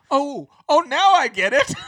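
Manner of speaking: high, laughing "oh, now I get it"; sudden dawning, laughing it out; laughing realization. Retold or imitated. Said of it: "and I was like".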